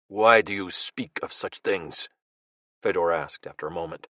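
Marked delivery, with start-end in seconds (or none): 0.1-2.1 slow, held-back anger; restrained rage. Acted. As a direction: keep the rage just under the lid. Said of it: "controlling the rage that seethed beneath".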